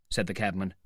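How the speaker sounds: usual speaking voice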